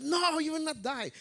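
very high acted outcry, without extra volume; fervent protest. Preacher repeats Peter's protest to Jesus, high and fervent. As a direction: protest in a quick, high-pitched voice. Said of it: "in his fervor".

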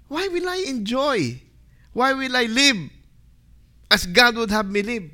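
high, questioning captive's complaint; indignant protest. Acted. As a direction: object in disbelief at the command. Voices the captive Israelite's protest.

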